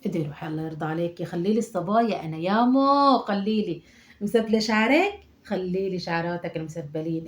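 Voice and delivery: ordinary talk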